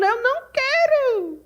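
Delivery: put-on high, slow child's refusal; child refusing food. Small boy refuses his food, high and slow. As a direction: whine it like a little boy. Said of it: "O menino tá falando".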